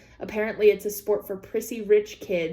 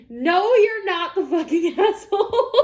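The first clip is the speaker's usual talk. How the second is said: higher and louder, laughing mid-word; laughing verdict; 7 semitones higher, 5 dB louder than usual. Real, not acted. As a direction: blurt it out through a laugh.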